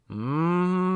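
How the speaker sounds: steady mechanical hum; hummed drone. A narrator's reading. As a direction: hum like a distant machine. Strange hum imitated.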